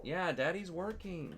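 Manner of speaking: high, slow, gentle coaxing. Real, not acted. Dad calms crying toddler.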